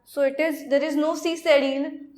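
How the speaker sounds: normal talking voice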